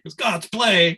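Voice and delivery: offended repeat of the words; indignant retort. Retold or imitated. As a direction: throw the phrase back, offended. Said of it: "it was the opposite mood".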